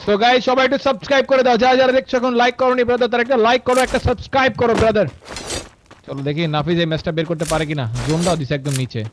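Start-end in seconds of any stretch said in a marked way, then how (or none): 0.0-5.1 YouTuber outro plea. Acted. Asks viewers to subscribe.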